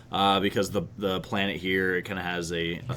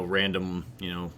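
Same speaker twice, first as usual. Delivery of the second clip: normal talking voice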